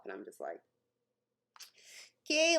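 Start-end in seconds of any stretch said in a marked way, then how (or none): 2.2-2.6 high, tense little okay; awkward. Retold or imitated. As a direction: retell an uneasy, high-pitched okay. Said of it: "like, awkward".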